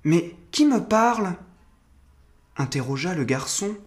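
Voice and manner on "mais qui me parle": put-on high, uneasy boy's question; anxious little boy voice, higher; worried young boy. A narrator's reading. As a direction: pitch it up like a puzzled child. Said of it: "en jetant des regards inquiets".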